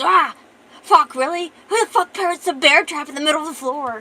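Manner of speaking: high, loud, exasperated yell; screaming in pain. Acted. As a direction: yell out in pained outrage. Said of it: "And then she screams".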